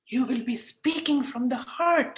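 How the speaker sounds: slow, sincere and heartfelt; a slow, sincere change of voice. Shown as a demonstration. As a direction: let the words come from the heart, unhurried. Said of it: "You see how I just changed my voice when I said speak from the heart?".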